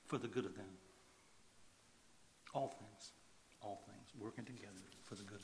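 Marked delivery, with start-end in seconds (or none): 2.5-5.4 hushed repeat. Acted. Preacher softly repeating the promise to the congregation, hushed. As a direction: let it sink in almost under the breath.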